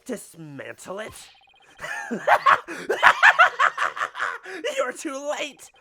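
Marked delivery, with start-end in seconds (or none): 1.8-4.7 high cackling villain laugh; evil maniacal laugh. Acted. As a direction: cackle like a madman. Villain reveals bomb threat.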